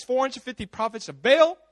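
ordinary talk, nothing marked

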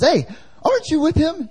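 high-pitched mock accusing servant girl; servant girl's challenge. Slave girl confronting Peter in the courtyard, high mock accusation. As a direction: put on a high voice and call him out.

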